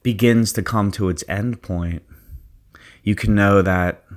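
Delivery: guided meditation. Acted. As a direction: ease them into their zone. Leads a guided visualization.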